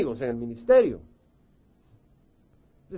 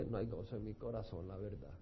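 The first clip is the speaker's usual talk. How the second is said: quiet confession; 7 semitones lower, 18 dB quieter than usual. Acted. Voicing a listener's sad confession.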